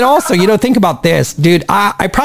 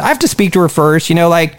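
ordinary talk, nothing marked

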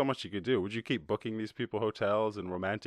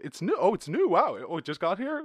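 higher mock wonder; fake-amazed sarcasm; gushing pretend surprise, pitched up; sarcastic high voice; 8 semitones higher, 4 dB louder than usual. Sarcastic reply to humans.

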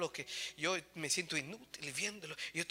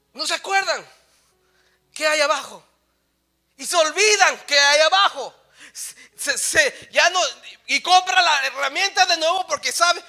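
loud, high pulpit voice; full-force preaching; 11 semitones higher, 19 dB louder than usual. Real, not acted. Preacher tells of forgotten tools buried below, full-force preaching. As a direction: drive the illustration home at a shout.

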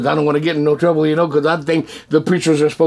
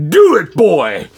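loud, forceful order; stern command; 3 semitones higher, 7 dB louder, slower than usual. Retold or imitated. God orders the reluctant man, stern loud command. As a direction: boom it out as an order that allows no argument. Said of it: "he went just like this".

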